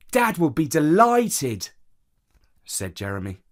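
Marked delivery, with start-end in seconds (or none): 0.1-1.7 higher, louder, drawn-out delight; slow, raised-pitch glee; delighted child. A narrator's reading. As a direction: stretch it out like a thrilled boy. Delighted nephew reacts in story.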